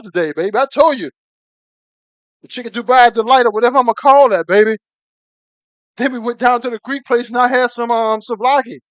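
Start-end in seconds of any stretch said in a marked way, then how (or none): none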